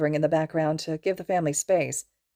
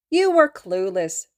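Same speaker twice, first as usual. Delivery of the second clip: slow, louder teasing jab; goading; 3 semitones higher, 6 dB louder, slower than usual. A narrator's reading. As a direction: drag it out to needle him. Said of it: "his mother goaded".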